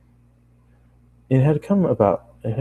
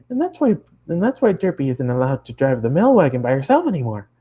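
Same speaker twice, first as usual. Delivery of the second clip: bubbly, much higher cartoon pony voice; high Pinkie voice; 4 semitones higher than usual. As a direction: chatter it up high like an excited pony. Pinkie voiced in read story.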